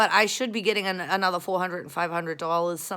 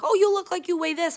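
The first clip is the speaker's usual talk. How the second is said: high, loud gushing quote; mock flatterer; 12 semitones higher, 7 dB louder than usual. Someone comments on her weight, mock high gushing. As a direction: pitch it up, imitating a flatterer.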